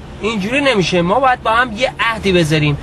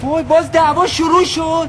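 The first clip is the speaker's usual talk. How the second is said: exasperated; 9 semitones higher, slower than usual. Acted. Couple bickering, exasperated sigh.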